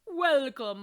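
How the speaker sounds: loud, high hearty welcome; booming welcome. Acted. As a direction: boom it out grandly. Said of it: "Maestro Provolone boomed".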